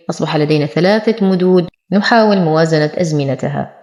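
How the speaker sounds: ordinary talk, nothing marked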